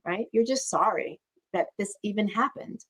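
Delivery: ordinary talk, nothing marked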